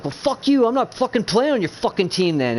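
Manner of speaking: high-pitched mock outburst; going off. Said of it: "he went off on him".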